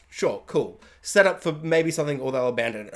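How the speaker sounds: low, soft, deadpan aside; unimpressed shrug. Retold or imitated. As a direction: shrug it off under your breath. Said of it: "I'm like".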